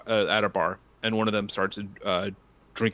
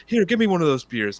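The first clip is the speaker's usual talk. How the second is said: higher, louder grabby patron; eager patron; 8 semitones higher, 7 dB louder than usual. Retold or imitated. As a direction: demand the beer. Other patron in joke.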